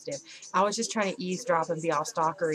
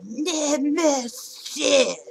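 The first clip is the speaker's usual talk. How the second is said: high, long drawn-out mock scream; stretched, high-pitched heckle; drunken fan's scream; 8 semitones higher, 4 dB louder, much slower than usual. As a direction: imitate a drunk girl screeching at a concert. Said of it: "That's how they kept screaming it".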